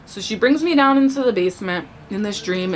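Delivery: ordinary talk, nothing marked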